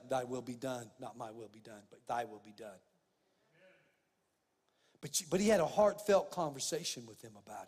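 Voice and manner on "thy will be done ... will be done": low, soft, submissive prayer; hushed surrender. Retold or imitated. Preacher acting out Jesus yielding to the Father, hushed surrender. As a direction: drop low and quiet, yielding.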